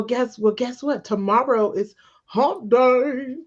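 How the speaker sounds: ordinary talk, nothing marked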